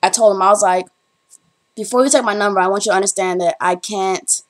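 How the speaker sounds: ordinary talk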